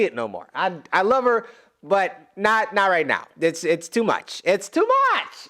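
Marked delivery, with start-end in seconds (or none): 4.4-5.5 high, squeaking wail of grief; overwhelmed near-sob whine; cracking wail. Real, not acted.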